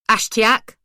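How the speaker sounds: calling out loudly at the door; shouted name. A narrator's reading. Narrator shouting for Ashtiak at his door, loud. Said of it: "I shouted".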